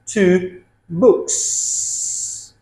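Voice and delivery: "books" with a long hissed s; s plural ending. Shown as a demonstration. Teacher models plurals, "books" ending in a drawn-out s. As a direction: hold the final s of "books". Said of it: "We have s".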